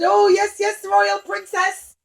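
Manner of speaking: bow and scrape eagerly; eager clown. Acted. Clown answers the princess, eager to please. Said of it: "and he said".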